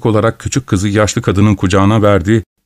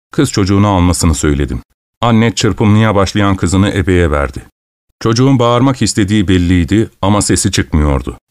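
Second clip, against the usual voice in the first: plain reading voice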